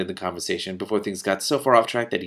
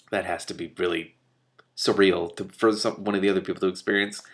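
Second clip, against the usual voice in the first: usual speaking voice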